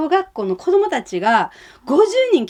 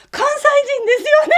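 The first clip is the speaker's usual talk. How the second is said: much higher, fast, amused quote; imitating the lady; 4 dB louder, much faster than usual. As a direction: toss it back quick and high with a laugh. おばさんの返答を再現. (笑いながら高く速く真似して)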